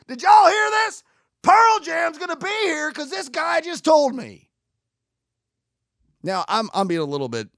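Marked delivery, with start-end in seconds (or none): 0.1-4.5 high shout to the whole crowd; overdramatic mock announcement. Mockingly announcing Pearl Jam to everyone, overdramatic shout. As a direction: yell it out in sarcastic, hammed-up excitement. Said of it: "over dramatic in this state".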